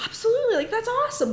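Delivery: very high, delighted yes; enthusiastic approval. Retold or imitated. Missionary approving the boy's decision, enthusiastic. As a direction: cheer it on in a high voice.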